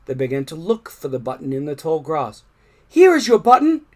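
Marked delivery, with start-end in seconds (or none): 2.9-3.9 high, loud eager call; excited cry. A narrator's reading. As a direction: Frog proudly calling out his find. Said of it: "cried Frog".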